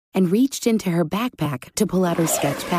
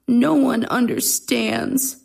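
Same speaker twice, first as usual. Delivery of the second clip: put-on sighing, slow child voice, higher; frowning dejection; 6 semitones higher, slower than usual. A narrator's reading. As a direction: sigh and sulk like a misunderstood boy. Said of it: "thought Gabe with a frown".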